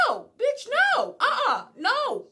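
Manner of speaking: loud, high, repeated no; emphatic refusal. Real, not acted. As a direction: refuse harder with each no. Emphatic refusal to stay silent.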